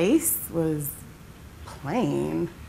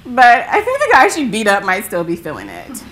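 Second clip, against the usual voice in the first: loud, amused retelling, higher at first; acted amusement with raised voice; imitating laughing friend; 4 semitones higher, 7 dB louder than usual. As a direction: play the friend talking between laughs. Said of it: "in between laughter".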